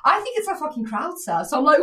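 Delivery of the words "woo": high, drawn-out "woo"; party whoop. Retold or imitated. Drunk girl crowd surfing, whooping. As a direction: whoop like a happy crowd surfer.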